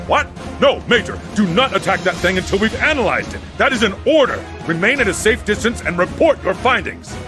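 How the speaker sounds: overacted anime dub. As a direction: forbid the attack, alarmed. Commander countermands attack order.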